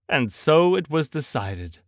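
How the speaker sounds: usual speaking voice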